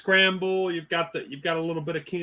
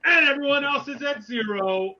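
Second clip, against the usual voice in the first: auctioneer voice; 10 semitones higher, 5 dB louder than usual. Acted. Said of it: "I had to get my auctioneer voice ready".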